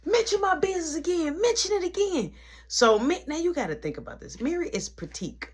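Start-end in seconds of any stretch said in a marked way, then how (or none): 0.0-2.3 high, heated mock threat; retold angry demand. Tracy snapping at Mary, heated and high. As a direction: throw the words at her, furious. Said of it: "She was mad. She was heated".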